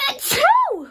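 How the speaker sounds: sudden high, loud sneeze. Real, not acted. Vlogger who warned she must sneeze, with a loud sneeze. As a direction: let a big sneeze burst out. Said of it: "j'ai envie d'éternuer".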